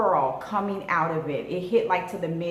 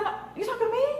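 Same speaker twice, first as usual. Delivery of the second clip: high, sassy challenge; mock indignant; 14 semitones higher, much faster than usual. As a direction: dare him to repeat it. Insulted by stranger.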